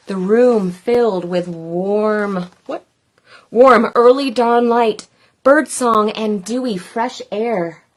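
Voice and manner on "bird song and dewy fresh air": ordinary delivery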